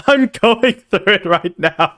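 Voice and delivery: slow, giggly put-on voice; laughing. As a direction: laugh through the words.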